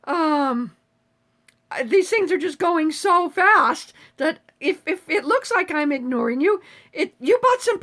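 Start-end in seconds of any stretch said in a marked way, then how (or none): none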